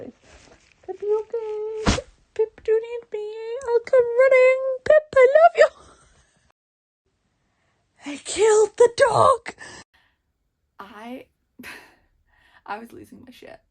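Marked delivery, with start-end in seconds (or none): 0.9-5.7 hammed-up tearful wail, very high and loud; wailed devotion. Reader voicing his instant thought for Pip, mounting wail. Wailing a book character's thoughts. 8.0-9.5 high, loud, drawn-out cry of dismay; distraught wail. Real, not acted. Reader reacting to the dog being killed, distraught wail. Upset reaction to dog's death.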